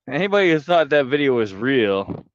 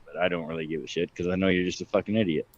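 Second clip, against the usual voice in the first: quick aside; 6 semitones lower, 8 dB quieter, faster than usual. Real, not acted. Dropping act, quick aside.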